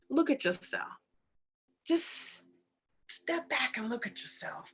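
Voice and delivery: soft, patient, hushed voice; calm God voice with pauses; gentle divine prompting. Acted. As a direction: quietly invite him to see.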